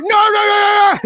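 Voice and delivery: high, loud string of refusals; mock panicked shouting. Fan imitating Copper before he runs off, panicked shout. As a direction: yell it high before bolting.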